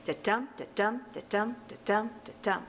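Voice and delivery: five even "da-DUM" units, stress on each "dum"; rhythmic "da-DUM", falling off on the last one; iambic pentameter beat. Shown as a demonstration. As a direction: chant "da-dum" like a metronome, light then heavy. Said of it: "say that a few times".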